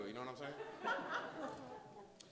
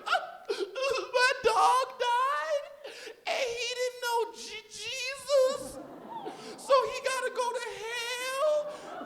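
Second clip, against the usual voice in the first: put-on very high, slow, tearful child's voice; hammed-up weeping girl; crying little girl; much slower than usual. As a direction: sob it out like a heartbroken little girl. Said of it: "starts up and crying".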